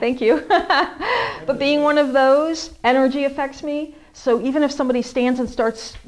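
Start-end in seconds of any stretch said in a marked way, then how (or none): 0.5-1.4 high laugh. Real, not acted. Laughs after help.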